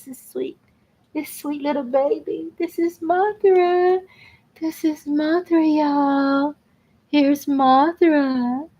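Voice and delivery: high, slow cooing to a kitty. Real, not acted. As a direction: coo the kitty's name up high. Showing her cat Mothra.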